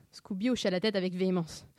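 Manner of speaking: usual speaking voice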